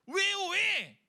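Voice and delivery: high, loud demand for a reason; indignant challenge. Acted. Believer challenging God in anger, indignant challenge. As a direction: fire back in protest.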